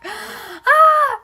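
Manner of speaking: high, loud yelp of pain; mock pain scream. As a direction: shriek as if alcohol hit a scrape, acted. Rubbing alcohol on the wound, acted.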